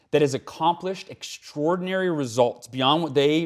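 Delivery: ordinary talk, nothing marked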